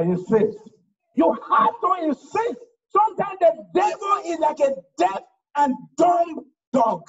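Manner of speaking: ordinary talk, nothing marked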